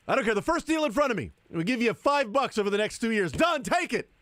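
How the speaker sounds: high, excited acted outburst; hammed-up high-pitched eagerness; eager mock deal-taker. Acts eager player snapping up first deal.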